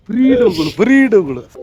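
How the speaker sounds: high-pitched teasing call-out; teasing accusation. Real, not acted. Vlogger tells viewers his friend is lying, high teasing accusation. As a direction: call out the fibs, pitch jumping up.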